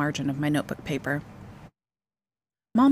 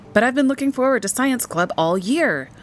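put-on higher, louder child's protest; rising upset voice; 8 semitones higher, 5 dB louder than usual. A narrator's reading. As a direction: protest with raised voice, holding back tears. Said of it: "Michael's voice rose".

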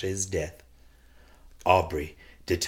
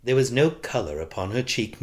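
plain reading voice

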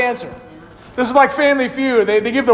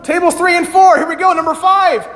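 calling out; 6 semitones higher than usual. Real, not acted. Calling out to the tables.

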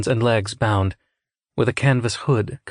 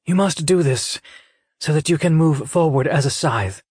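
higher, intent character voice; earnest scythe mentor; 6 semitones higher than usual. A narrator's reading. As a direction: urge the candidate on with solemn insistence.

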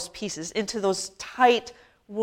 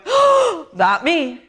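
high mock gasp of disbelief; startled gasp; 6 semitones higher, 4 dB louder, slower than usual. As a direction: gasp as if caught off guard. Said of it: "a communal gasp of people going".

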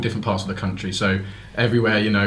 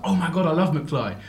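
higher, gushing retold message; excited fan quote; 8 semitones higher than usual. Bandmate retelling his message about loving McFly, excited. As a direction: act out a fan's gushing text. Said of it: "And I was like".

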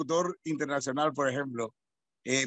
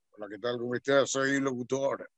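low, soft announcer parody; guttural announcer; 8 semitones lower, 5 dB quieter than usual. Acted. Host mimicking announcers' self-introduction, guttural voice. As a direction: put on a deep guttural radio voice. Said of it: "la voz gutural".

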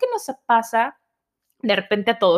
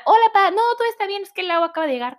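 reassuring sister; 9 semitones higher, faster than usual. Retold or imitated. Sister calming dad on phone.

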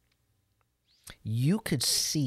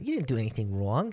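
put-on quick New York accent on "You didn't do anything wrong"; 3 semitones lower, faster than usual. Demoing the girl's New York accent, quick and clipped. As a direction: rattle it off fast like a New Yorker. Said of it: "it's not just an Italian accent".

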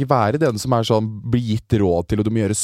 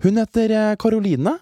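imitating herself; 9 semitones higher than usual. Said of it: "Da er jeg sånn".

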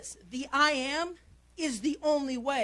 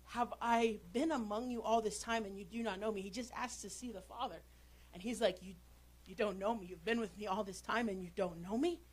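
quiet rebuke; 4 semitones lower, 7 dB quieter than usual. Acted. Quiet recitation of Jesus' rebuke.

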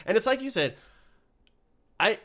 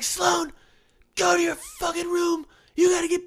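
much higher, louder mock yelling; imitating angry parents; 5 dB louder than usual. Parents yelling at their daughter Sloan, mock angry shout. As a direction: bark it at the kid like a fed-up parent. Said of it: "they'll be like".

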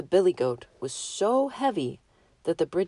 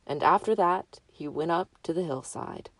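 ordinary delivery, nothing marked

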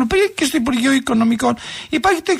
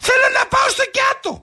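high, wailing demand; insistent wail; 8 semitones higher than usual. Acted. Refused customer insists on getting to Kiato, insistent wail. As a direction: cry it out high, refusing to accept no.

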